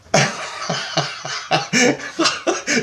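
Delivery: airy high giggle; high breathy laugh. Real, not acted. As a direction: laugh it off in relief. Laughing at the outcome.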